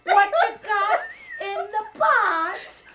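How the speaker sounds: put-on a Caribbean accent, loud and laughing, high; hosting cook-up segment, voiced in a mock Caribbean accent. Host doing a jokey Jamaican-style accent, laughing. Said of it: "I don't know what accent that is".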